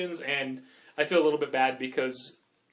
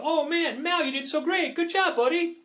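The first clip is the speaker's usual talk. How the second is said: put-on high, bright praise for a kid; cheery praise; 13 semitones higher than usual. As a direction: gush over the kid's good work. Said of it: "I looked in the box and I go".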